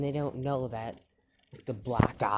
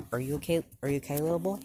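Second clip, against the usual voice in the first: higher, lilting concerned questions; concerned girl; 5 semitones higher, 4 dB louder, much faster than usual. Acted. Girl finding Deku in the street, concerned. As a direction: check on a small child, voice lifting. Girl gently checks on wandering child.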